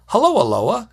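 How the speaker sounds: hello sung up high and friendly; friendly greeting. Shown as a demonstration. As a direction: greet the spirit brightly with a lift on hello. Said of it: "walk right up to one of them and say to a Loa".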